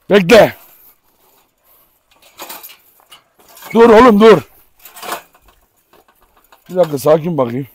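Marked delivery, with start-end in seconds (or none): 0.1-4.5 loud commands to halt the dog; sharp command. Real, not acted. Owner stops his pulling dog with loud orders. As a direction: bark the order to stop.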